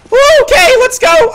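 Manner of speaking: very high, loud, thrilled cheer; excited whoop. Real, not acted. Surviving on one health point.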